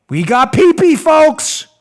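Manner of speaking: mock excitement. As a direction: crow it like big news. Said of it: "Here's Rosenstein".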